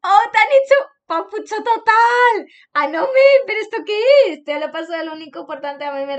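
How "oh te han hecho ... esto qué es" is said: high, loud delighted shriek; excited squeal. Real, not acted. Delighted squeal at a fan drawing. (grito de emoción agudo)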